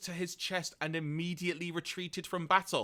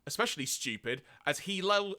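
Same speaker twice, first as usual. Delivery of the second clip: usual speaking voice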